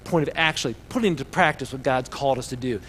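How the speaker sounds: usual speaking voice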